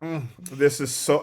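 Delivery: lightly hammed-up savoring; mock gourmet bliss; food critic heaven. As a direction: imitate someone relishing a first bite. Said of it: "almost in food critic heaven".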